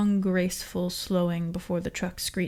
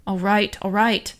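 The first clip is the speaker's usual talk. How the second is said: irritable; 6 dB louder than usual. A narrator's reading. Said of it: "the guard said irritably".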